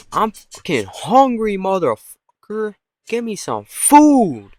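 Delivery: high, loud, drawn-out hungry demand; comically bellowing hungry dog; swearing dog. Pretend. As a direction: howl the demand, stretching "food". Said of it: "dogs are swearing".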